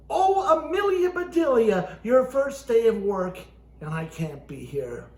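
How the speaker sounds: bright, loud read-aloud voice; storybook reading. Acted. Ventriloquist reading the book to the audience, loud and lively.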